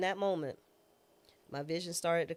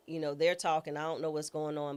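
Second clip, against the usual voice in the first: ordinary talk, nothing marked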